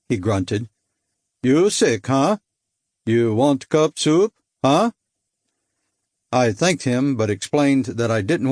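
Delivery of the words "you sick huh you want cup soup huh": put-on broken English with a light Baltic accent, slow and friendly. Giant sailor offering soup to a seasick man, light Baltic accent. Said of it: "His accent was hard to place".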